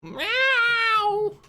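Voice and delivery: high squeaky cat meow; teasing cat impression; mock meow. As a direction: imitate the cat mockingly. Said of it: "That's what you sound like".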